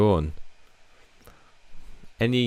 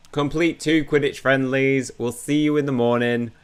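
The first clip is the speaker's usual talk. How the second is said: ordinary talk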